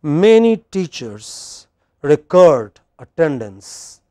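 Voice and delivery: slow model sentence, "record" as reCORD; slow teacher's demo of reCORD as verb; verb stress on reCORD. Shown as a demonstration. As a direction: pause between phrases, CORD strong in "record". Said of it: "Just listen first".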